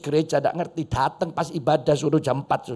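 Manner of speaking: usual speaking voice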